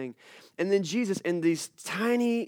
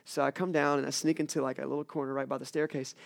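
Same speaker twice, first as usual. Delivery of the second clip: normal talking voice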